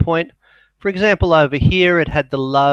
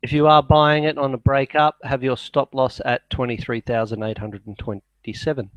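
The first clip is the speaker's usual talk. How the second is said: ordinary talk, nothing marked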